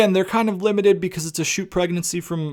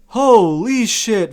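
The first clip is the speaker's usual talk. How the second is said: 1 exasperated; 5 semitones higher, 5 dB louder, much slower than usual. Real, not acted. Venting at Vince.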